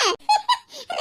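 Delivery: high teasing laugh. Acted. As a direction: laugh to tease the girl. Teasing about mother-in-law quarrel.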